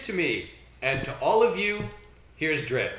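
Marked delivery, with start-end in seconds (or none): none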